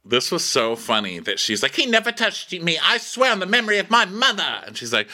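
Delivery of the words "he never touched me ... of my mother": high, hammed-up plea of innocence; mock oath. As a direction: swear it in a high pleading mimicry. Mimics the princess's oath.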